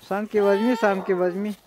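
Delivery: loud, high call repeated. Real, not acted. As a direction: shout the instruction across the slope. Shouts to daughter about sled. (громко кричит издалека)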